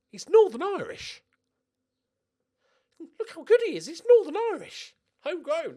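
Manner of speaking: loud, high, hammed-up Northern Irish accent. Manager proudly presenting his new Northern Irish signing, in a hammed-up Northern Irish accent. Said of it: "That's where I live right now".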